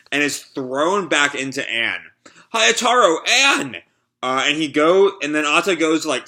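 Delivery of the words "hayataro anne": higher, louder cry of alarm; calling out. Acted. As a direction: shout their names in alarm. Character cries out names.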